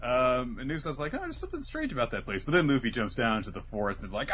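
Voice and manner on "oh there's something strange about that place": acted unease. Acted uneasy Usopp quote.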